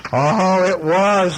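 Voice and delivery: high, eager exclamation; excited. Acted. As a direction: burst out, thrilled. Characters reply eagerly.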